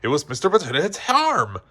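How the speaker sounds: plain reading voice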